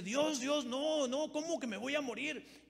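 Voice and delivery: high, loud crying plea to God; pleading cry. Retold or imitated. King Ezequías weeping to God, begging to live: high loud cry. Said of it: "él empieza a llorar y dice".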